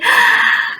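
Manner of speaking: high gasp of pretend surprise; teacher's playful gasp for children; mock gasp. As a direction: gasp as if told exciting news. Pretends to hear puppet's news.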